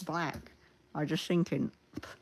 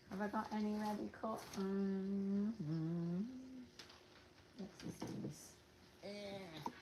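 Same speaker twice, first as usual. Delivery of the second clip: quiet mutter; 5 semitones higher, 14 dB quieter, slower than usual. Real, not acted. Muttering while searching.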